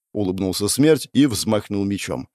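ordinary delivery, nothing marked

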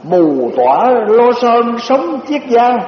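slow, drawn-out poem recitation; recited verse. Shown as a demonstration. Monk reciting a translated poem, slow and drawn out. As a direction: savor each word of the verse. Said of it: "người ta dịch ra cái bài này".

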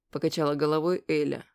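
ordinary delivery

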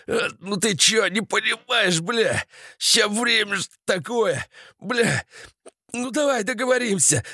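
high, strained pleading through pain; wounded soldier begging the gunman; wounded moaning plea. Acted. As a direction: beg for your life, hurting and coughing. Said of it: "Сеня стонет".

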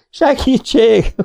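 high, drawn-out cry for help; yelling for help. A narrator's reading. Drowning swimmer calls to shore, yelling. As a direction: holler as if going under. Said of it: "ordította Kásás Zoli".

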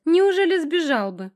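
very high, loud, drawn-out mock surprise; ringing taunt. A narrator's reading. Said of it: "звонкий Наташкин голос".

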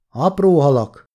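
lightly voiced bearded partisan shouting the answer over; answering a shout from a distance, understated; calling back. A narrator's reading. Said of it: "kiáltott vissza egy szakállas partizán".

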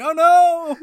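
high, loud fake "Oh no!"; mock panic cry. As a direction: shout it like a flailing man in panic.

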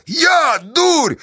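very high, loud mock shock; showy amazement. A narrator's reading. Pozdey answering Kornila, theatrical amazement. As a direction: overplay your astonishment. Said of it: "напоказ изумился Поздей".

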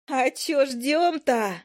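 high, slow cheery call; cheerful fairy. Acted. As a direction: chirp it out cheerfully. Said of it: "весело прорала Файка".

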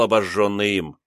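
ordinary delivery

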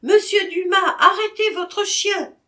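high, loud cry to stop the dog; shouting. Acted. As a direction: yell after the thief. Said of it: "criait la femme". (cri aigu et fort)

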